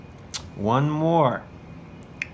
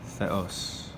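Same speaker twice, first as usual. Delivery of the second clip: soft, lower murmur to oneself; thinking to oneself; 4 semitones lower, 7 dB quieter than usual. Shown as a demonstration. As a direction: say it softly, as if thinking it. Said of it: "gonna think to themselves".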